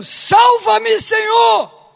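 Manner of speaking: high, full shout for rescue; clamor gritado. Acted. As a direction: shout the plea with full force to the last word. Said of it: "é ou não é o clamor". (grito de clamor, bem alto)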